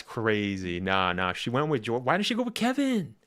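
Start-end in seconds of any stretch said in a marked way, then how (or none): 2.0-3.1 high-pitched disbelieving question; incredulous surprise. Real, not acted. Still reacting in disbelief to story.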